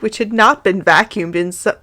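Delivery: plain reading voice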